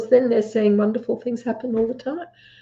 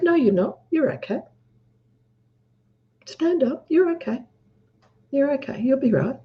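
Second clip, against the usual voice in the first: reassuring mum; 4 semitones higher, 4 dB quieter than usual. Retold or imitated. Mum reassuring her fallen son.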